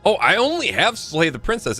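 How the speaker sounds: usual speaking voice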